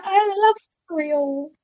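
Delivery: slow, lightly voiced cartoon princess; a hint of a sweet princess; Princess Peach impression. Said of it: "Was that your best Princess Peach impression?".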